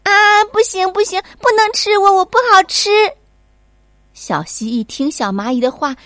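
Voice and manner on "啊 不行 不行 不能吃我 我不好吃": high, loud panicked pleading, rushed; a scared little girl begging not to be eaten; frightened child. Acted. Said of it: "吓坏了". (吓坏了的小女孩，尖声慌忙求饶)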